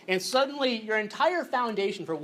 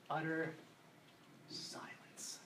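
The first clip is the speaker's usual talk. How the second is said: whispered silence; 4 semitones lower, 17 dB quieter, much slower than usual. Shown as a demonstration. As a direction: breathe the word out in a whisper. Dramatic pause on utter silence.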